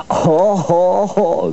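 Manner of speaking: high, hearty "haw haw" laugh; mock guffaw. A narrator's reading. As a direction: guffaw like rowdy storybook trucks. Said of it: "guffawed the trucks".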